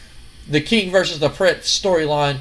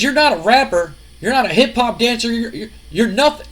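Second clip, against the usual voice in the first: scolding father; 6 semitones higher, 3 dB louder than usual. Retold or imitated. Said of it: "he goes".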